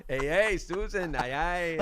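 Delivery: high, loud, bad cartoon Mexican accent; terrible Speedy Gonzales voice. Retelling a botched audition, a bad cartoon Mexican accent. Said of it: "I did this terrible Speedy Gonzales type voice".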